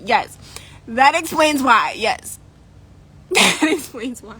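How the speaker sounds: slow, laughing repeat. Real, not acted. Still cracking up, repeats joke.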